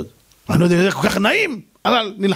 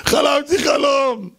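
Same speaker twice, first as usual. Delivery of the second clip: high, slow, drawn-out shout; a panicked man crying to the rabbi about his dream; dramatic cry; 7 semitones higher, much slower than usual. Retold or imitated. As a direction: wail it out dramatically. Frantic visitor's dream announcement.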